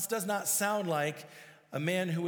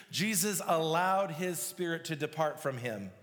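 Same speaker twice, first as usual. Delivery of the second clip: ordinary talk, nothing marked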